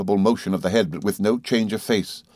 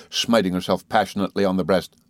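ordinary delivery, nothing marked

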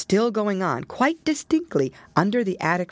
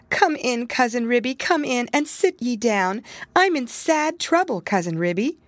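tearful; 7 semitones higher than usual. A narrator's reading. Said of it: "said Tabitha, shedding tears".

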